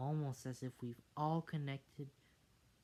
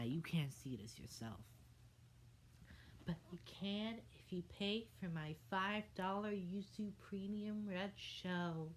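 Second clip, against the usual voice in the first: usual speaking voice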